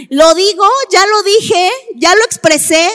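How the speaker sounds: loud, clear statements, each one separate; clear assertion. Shown as a demonstration. Speaker showing how to voice dissatisfaction in a relationship, clear assertion. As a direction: state it firmly and loudly, without tears or anger. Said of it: "sino con claridad".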